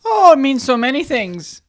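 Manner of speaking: sing-song Midwestern lilt, played for laughs; Minnesota accent. Co-host asked what Christmas means, answers in mock Minnesota accent. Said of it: "you sounded like you were from Minnesota".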